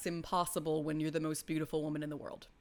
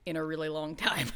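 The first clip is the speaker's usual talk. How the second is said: ordinary talk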